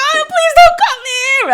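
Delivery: very high, loud mock wail; panicked begging. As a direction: beg the doctor in a squealing panic. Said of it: "I'm, like, freaking".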